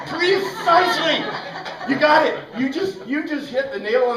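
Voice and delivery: loud, laughing delight; laughing "you got it" to a student; laughing praise. Real, not acted. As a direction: cheer the joke through laughter. Delighted reply to student's joke.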